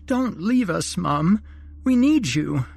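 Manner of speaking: put-on a child begging mother not to go; pleading child. A narrator's reading. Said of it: "Alice pleaded".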